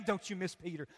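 normal talking voice

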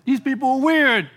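my body shouting no at this weird church; body's loud protest. Retold or imitated. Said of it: "My body said".

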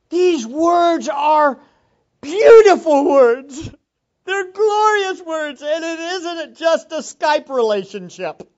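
loud, high, fervent preaching; impassioned sermon cry; preaching at full force. Real, not acted. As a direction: hammer every word to the congregation.

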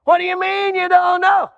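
a gatekeeper who can't believe it; exasperated gatekeeper. Acted. Gatekeeper questions a newcomer at heaven.

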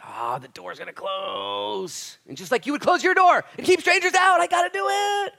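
building from drawn-out wail to high shout; impassioned high yell; anguished warning. Acted. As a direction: cry out the warning at full force. Voicing the Lord's anguished warning.

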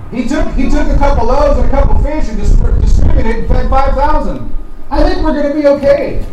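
loud, rising sermon climax; preaching full force. Real, not acted. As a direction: drive it home at full voice.

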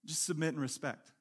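lightly voiced soft prompting; gently urge, voice dropped; voice of the Spirit. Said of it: "you hear the Spirit saying".

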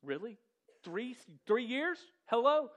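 a loud, hammed-up incredulous rebuke; exasperated shout. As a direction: throw up your hands in disbelief. Exasperated preacher mocking harsh Jesus.

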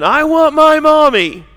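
put-on high, loud toddler wail; screaming child. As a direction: cry out like a child wanting mother. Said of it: "you were screaming".

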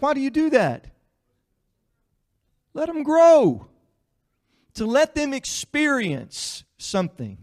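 loud, high preacher climax; preaching at full force. Real, not acted. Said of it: "preaching climax".

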